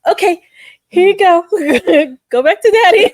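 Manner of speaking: put-on high, laughing panic handing the baby back to daddy; nervous high handoff. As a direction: squeak it out, desperate to give her back.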